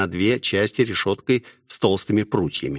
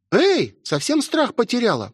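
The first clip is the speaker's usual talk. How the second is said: возмущённо. A narrator's reading. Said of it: "возмутилась Лили".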